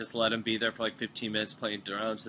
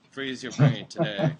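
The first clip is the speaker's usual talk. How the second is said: louder, slow ad pitch; commercial voice; 7 dB louder, slower than usual. Acted. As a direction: sell it like a TV ad. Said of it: "I'll do the commercials".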